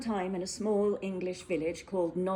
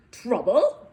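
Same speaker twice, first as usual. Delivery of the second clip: louder spluttering outraged question; spluttered disbelief; 4 semitones higher, 12 dB louder than usual. Acted. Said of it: "spluttered the mayor".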